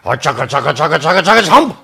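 slow, stretched words with big swoops; animated children's storytelling. Acted. Host reads to children, exaggerated storytelling. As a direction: chomp through the eating noises, loud and rising.

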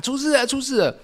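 high mock shout of trouble; shouting alarm. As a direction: call out that something's wrong, pitched high. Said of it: "同班同学喊". (高声模仿大喊“出事了”)

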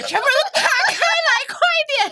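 excited retelling. Retold or imitated. Retelling friends' eager rush.

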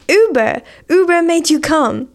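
loud triumphant 'Uber'; punchline shout. Retold or imitated. (大声喊出笑点 Uber)

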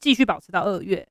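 ordinary delivery

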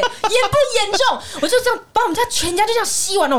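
high-pitched wail breaking into laughter; comic outrage. Retold or imitated. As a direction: exaggerate the absurdity, laughing as you go. Comic outrage over her own vacuuming. (又笑又夸张地高声抱怨)

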